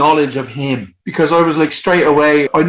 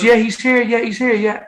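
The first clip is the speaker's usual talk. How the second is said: high-pitched imitation of her eager reply; retold flustered phone answer, high voice; eager confirmation; 8 semitones higher than usual.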